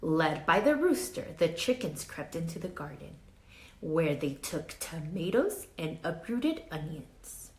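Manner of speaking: put-on lively read-aloud for children; story narration. A narrator's reading. Teacher reading a storybook to her class, lively read-aloud. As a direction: tell it like a picture book to kids. Reads picture book to class.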